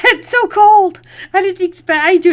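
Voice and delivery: high, loud, laughing through words. Real, not acted. Laughing shock after the twist.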